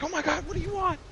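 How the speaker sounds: hammed-up fright at a shadowy figure; mock terrified. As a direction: friend woken at night, pretend panic. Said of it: "she's like".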